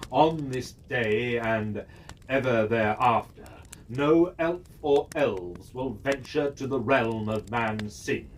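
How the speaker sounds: low, measured reading of a stern edict; solemn official proclamation; formal decree reading. Acted. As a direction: announce it as the council's cold command. Reads the council decree.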